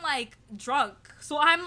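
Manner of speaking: normal talking voice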